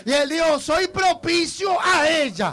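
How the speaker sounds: fervent preaching, building to high, loud cries; prophetic preaching. Real, not acted. Pastor telling Joana God has answered, impassioned prophecy. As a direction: proclaim God's word at full force.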